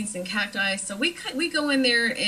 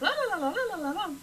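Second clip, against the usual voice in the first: mock chatter; 10 semitones higher, much faster than usual. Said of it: "And I was like".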